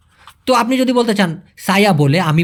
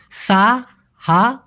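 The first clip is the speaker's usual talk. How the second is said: slow syllable by syllable; much slower than usual. Shown as a demonstration. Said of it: "মূলত ফুল form হচ্ছে".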